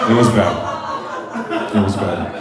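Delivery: a dragged-out low repeat; slow, low, laughing drag. Shown as a demonstration. Comic milking the line for the crowd, low and slow. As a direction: drop the pitch and stretch it, chuckling.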